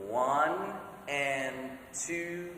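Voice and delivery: slow, steady counting with sung counts; slow, steady confident count. Shown as a demonstration. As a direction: count out slowly and surely. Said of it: "do it now with confidence".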